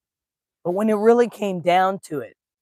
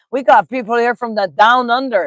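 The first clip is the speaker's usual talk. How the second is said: higher, louder mock Australian accent; 5 semitones higher, 8 dB louder than usual. Livestreamer greets viewers from down under, mock Australian accent. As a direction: have a playful go at an Australian accent. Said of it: "didn't do that accent any justice".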